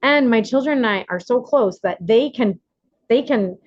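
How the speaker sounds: ordinary delivery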